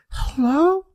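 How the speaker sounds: a drowsy woman picking up a late-night call; Jackie on the phone. Retold or imitated. Comic imitating Jackie answering the phone, high-pitched. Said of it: "you hear her like".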